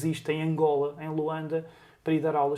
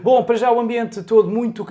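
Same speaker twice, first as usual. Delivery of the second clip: louder live radio correspondent voice; 6 semitones higher, 8 dB louder than usual. Retold or imitated. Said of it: "aprendi a fazer aquela voz de rádio".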